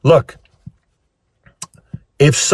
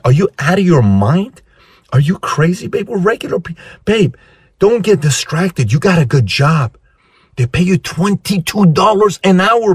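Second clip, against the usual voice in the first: higher-pitched incredulous pleading; discouraging girlfriend; 6 semitones higher than usual. Acted. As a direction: plead with your partner to be realistic. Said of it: "girlfriend stops".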